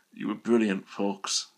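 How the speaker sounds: soft, fading thick thanks; grateful but too weak to push the voice. Acted. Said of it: "said Harry thickly".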